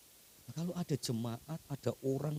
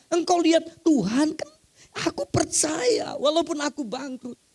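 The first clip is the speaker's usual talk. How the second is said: much higher and louder, a hammering pulpit cry; full-force preaching; 12 dB louder than usual. Acted. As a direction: preach it out to the whole hall.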